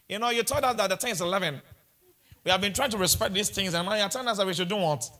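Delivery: ordinary talk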